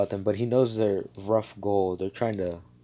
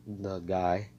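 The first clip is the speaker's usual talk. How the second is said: normal talking voice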